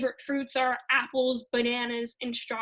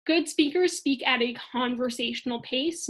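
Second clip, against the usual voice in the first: ordinary talk, nothing marked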